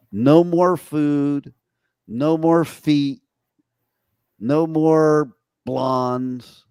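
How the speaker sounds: slow and deliberate; emphatic list. Acted. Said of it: "announcing the makeover".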